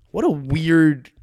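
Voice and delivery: what a weird, each word stretched out slowly; slow drawn-out emphasis. Real, not acted. Musing on the striker, drawn-out wondering slowness. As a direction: drag out what a weird to savour it. Speaker restates the phrase.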